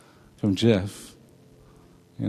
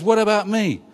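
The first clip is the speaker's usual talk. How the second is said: put-on peeved older son; 7 semitones higher than usual. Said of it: "peeved about that and says".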